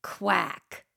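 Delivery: a soft, quiet duck quack; mock duck call for the kids. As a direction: quack it gently like a little duck. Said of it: "A duck says".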